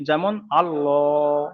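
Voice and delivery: যেমন আল্লাহ drawn out slowly with a thick, rounded lam; teacher's slow model; slow heavy Allah. Shown as a demonstration. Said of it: "ল করে পড়তে হবে".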